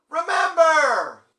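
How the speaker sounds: long, high holler with voice cracking; very loud yell. Retold or imitated. Fan yells a song request at the singer, cracking shout. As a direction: bellow it across a concert hall, drawn out. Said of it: "and I very loudly".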